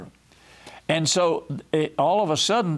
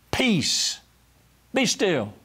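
commanding rebuke; 6 semitones higher, much slower than usual. Acted. Jesus rebuking the wind and sea.

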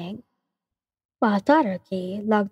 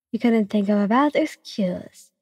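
usual speaking voice

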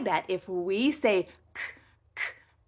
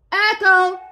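loud echo; 8 semitones higher, 10 dB louder than usual. Shown as a demonstration.

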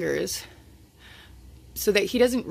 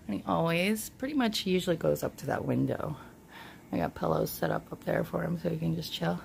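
normal talking voice

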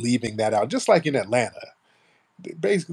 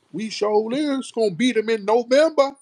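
very high, loud mock FBA accent; hammed-up FBA accent, wailed out like a caricature; mock her FBA accent at a high pitch; exaggerated FBA accent; 13 semitones higher, 4 dB louder than usual. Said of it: "really talking with this F B A accent".

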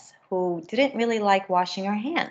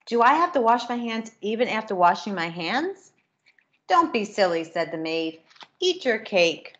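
lively storybook reading with character voices; reading to children; 3 semitones higher than usual. A narrator's reading. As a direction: read it brightly to a class of kids. Reads picture book to children.

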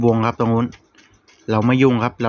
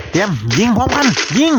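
raised, higher-pitched shout to fire; real battle call, urgent and raised; urgent command; 10 semitones higher, 4 dB louder than usual. Real, not acted. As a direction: call the squad into action, loud and high. Calling the squad to fire together.

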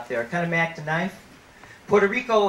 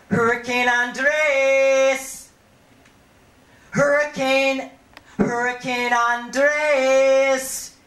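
long, slow, loud calls, higher; calling like a mother; 7 semitones higher, 4 dB louder, much slower than usual. Acted. Mother calling her son home across the dancehall, long loud calls. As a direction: stretch the name out like calling a child home. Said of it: "She calls him like a mother".

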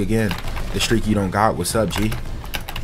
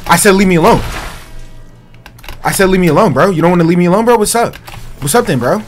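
loud, raised-pitch challenge; heated, high and loud; confrontational yell; 5 semitones higher, 5 dB louder, faster than usual. Real, not acted. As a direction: call them out at full voice. Player yelling, challenging opponents.

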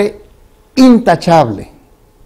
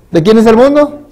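más agudo y fuerte, subiendo al final; pregunta repetida más alta; 8 semitones higher, 5 dB louder than usual. Acted. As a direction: repetir la pregunta aún más arriba, insistiendo. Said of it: "Hermanos, ¿de quién es el mundo?". (predicador insiste ante la congregación, más agudo y fuerte)

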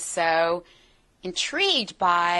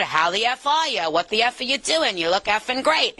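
put-on New York accent; 3 semitones higher than usual. Imitating her cursing family from New York, New York accent. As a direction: toss it off in casual Bronx New Yorkese. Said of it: "I also have family from New York".

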